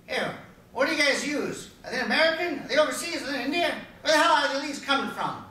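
loud, high-pitched grilling; demanding caller. Acted. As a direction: fire off suspicious questions, high and loud. Acting out callers grilling him about leads.

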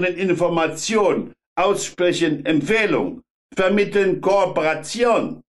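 put-on high, hard Polish-accented inspector. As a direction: bark it in rough Polish-accented German.

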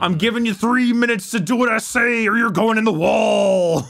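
gravelly-free hyped wrestler shout; wrestling promo. Acted. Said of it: "This has turned into a wrestling promo".

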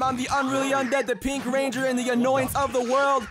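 character voice. Acted. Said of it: "character voices".

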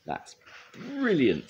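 proud, high, drawn-out delight; slow, high-pitched thrilled praise; Scoop. A narrator's reading. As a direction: stretch it out with pride. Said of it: "said Scoop proudly".

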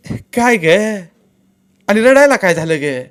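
alarmed neighbour woman; worried aunt asking. Acted.

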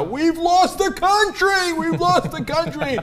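high, slow hammed-up panic cry; mock screaming. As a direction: wail it like doom is coming. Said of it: "screaming from the hilltops".

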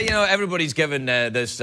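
ordinary talk

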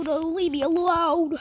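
ordinary delivery

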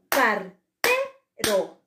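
Cartero in slow separate syllables, TE punched; stress on middle syllable. Shown as a demonstration. Teacher showing the tonic syllable, Cartero slowly with a loud TE. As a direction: split up Cartero and hit TE hard. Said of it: "tenía mayor fuerza de voz".

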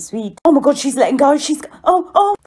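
ordinary talk

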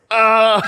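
high, loud imitated cry; mock yell. Imitating Sam as the dancers return, high mock yell. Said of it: "And he's like".

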